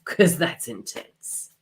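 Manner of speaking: slow whisper with a breathy laugh at the start. Real, not acted. Reader doing remote energy work, slow laughing whisper.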